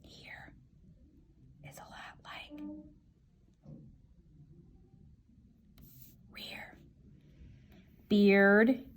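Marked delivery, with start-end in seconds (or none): 0.0-6.8 whispering while sorting. Real, not acted. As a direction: murmur it to yourself under your breath. Quiet sorting to self.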